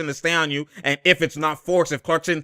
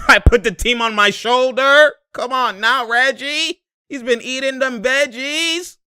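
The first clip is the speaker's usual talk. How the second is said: higher, mock-boastful player voice; hyping player voice; 9 semitones higher, 3 dB louder than usual. Voicing Reggie bragging about his game, higher. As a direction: brag like the hot player. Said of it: "voicing Reggie hyping himself".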